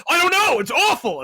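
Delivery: loud, high, fed-up outburst; angry fan. Retold or imitated. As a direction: blurt it out like an enraged fan. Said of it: "rage people who are like".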